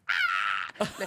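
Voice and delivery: deep gasp; deep shocked ah; low startled ah. Retold or imitated. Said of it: "I was like".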